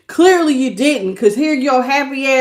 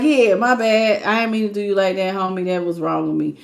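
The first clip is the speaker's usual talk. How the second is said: lower, quieter, trailing off into a mumble; mumbled apology; 5 semitones lower, 4 dB quieter than usual. Retold or imitated. Prideful ex mumbling a half-apology, low and quiet. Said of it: "They just be like".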